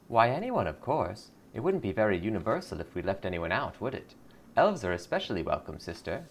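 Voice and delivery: sugary cult greeter; lightly voiced sweet cult recruiter. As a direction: welcome her in like a smiling recruiter.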